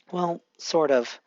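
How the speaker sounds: plain reading voice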